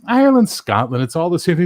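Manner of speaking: high, loud put-on woman's voice; chirpy high customer; a breezy high lady voice. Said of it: "She's like".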